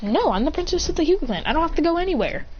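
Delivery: high, insistent bratty refusal; entitled princess. Retold or imitated. Princess refusing to leave his room, haughty and defiant. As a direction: pitch it up like a spoilt princess.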